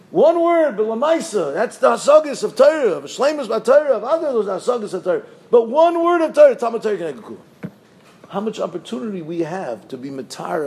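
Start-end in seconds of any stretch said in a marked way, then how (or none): 0.1-7.4 loud, high preaching cry; forceful preaching. Real, not acted. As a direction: proclaim it to the whole hall. Preacher thundering about Torah.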